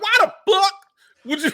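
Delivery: high, loud laughing yell; incredulous yell. Retold or imitated. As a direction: shout it in disbelief. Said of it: "So I was like".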